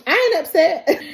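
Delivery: high, loud mock huff; mother insisting she's not upset, huffy; indignant denial. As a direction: snap back that she's fine. Tina denies being upset.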